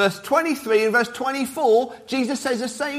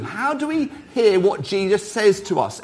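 ordinary delivery

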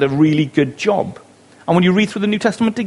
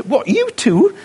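delighted surprise; 9 semitones higher, slower than usual. Retold or imitated. C S Lewis friendship moment retold.

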